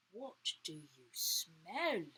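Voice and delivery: plain reading voice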